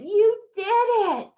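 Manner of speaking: put-on proud teacher cheering a child; enthusiastic praise. Shown as a demonstration. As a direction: stretch out "You did it" with real delight. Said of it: "If we replace it with".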